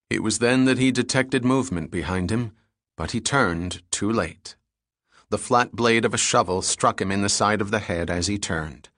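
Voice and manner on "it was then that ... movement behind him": usual speaking voice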